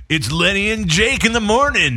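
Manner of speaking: high, loud morning-show DJ; morning radio DJ. Pretend. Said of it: "We could fake a whole".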